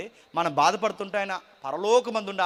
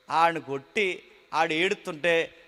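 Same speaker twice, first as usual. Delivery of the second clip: usual speaking voice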